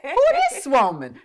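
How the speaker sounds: loud, high mock disbelief; incredulous. Retelling meeting the host, loud incredulous. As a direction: blurt it out in shock. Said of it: "And I'm like".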